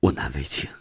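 low, soft shy admission; embarrassed. Acted. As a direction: admit your embarrassment under your voice. (难为情地低声说)

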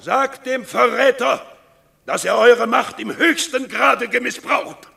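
viel höher und lauter, empört; zornige Anklage. Acted. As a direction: schleudere die Anklage dem Senat entgegen. Öffentliche Anklage vor dem Senat. (Ankläger ruft empört viel höher und lauter vor dem Senat)

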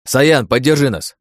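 slow loud call for support. A narrator's reading. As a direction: shout for backup across the field. Calling to sniper in battle.

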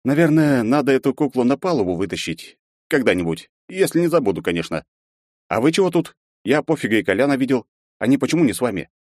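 breezy, a little careless; lightly voiced casual hero.

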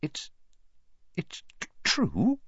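halting stammer, rising from very soft; falter, barely getting the word out. A narrator's reading. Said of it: "faltered Professor McGonagall".

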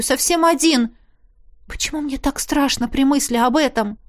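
usual speaking voice

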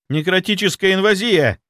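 high-pitched, startled announcement; breathed out in shock. A narrator's reading. Young inquisitor reporting what the scanner shows, alarmed. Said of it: "выдохнул Матвеев".

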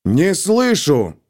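slow, hammered "Не слышу!"; sharp demand. A narrator's reading. Colonel pressing silent captain, sharp slow demand. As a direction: bark it, word by word.

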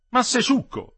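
high, loud exclamation; exclaimed scolding. Acted. Grandmother scolding Metello for jumping off, loud sharp exclamation. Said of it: "esclamò la nonna".

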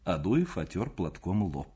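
plain reading voice

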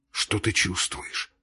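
slow, hushed whispered question. A narrator's reading. Levin asks his dying brother, whispering. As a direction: ask it softly, all breath, no voice. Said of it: "спросил шёпотом Левин".

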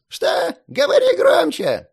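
put-on high loud old man yell; deaf old man shouting.

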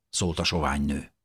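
ordinary delivery, nothing marked

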